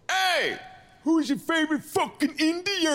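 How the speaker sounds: high, rowdy call across the room; rowdy yell. Retold or imitated. Man at the bar hollers at the big dude, rowdy and high.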